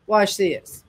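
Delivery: ordinary talk, nothing marked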